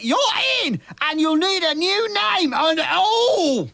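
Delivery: high, slow cockney. Acted. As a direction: ham up the London geezer. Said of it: "me old mucker".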